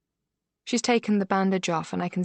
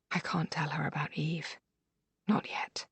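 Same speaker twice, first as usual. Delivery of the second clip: hushed inner voice; whispered thought; 7 dB quieter than usual. A narrator's reading. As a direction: whisper it to yourself. Narrator's private thought.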